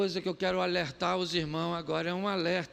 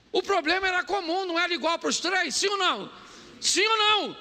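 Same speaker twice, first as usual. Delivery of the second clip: high, loud pulpit climax; full-force preaching; 9 semitones higher, 3 dB louder than usual. Real, not acted. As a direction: drive the point home to the congregation.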